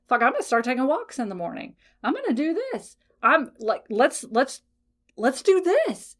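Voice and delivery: imitating eager resolve, pitch jumping high; fired-up self-talk. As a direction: hype yourself up, pitch leaping up. Voicing someone's motivated self-talk.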